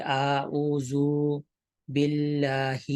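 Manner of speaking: slow اعوذ باللہ as "aa-oo-zoo billaahee"; needlessly lengthened vowels. Shown as a demonstration. Teacher shows the lengthening mistake, vowels dragged out in اعوذ باللہ. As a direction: stretch every short vowel in اعوذ باللہ. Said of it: "تو بلا وجہ ہی لمبا کر دیا".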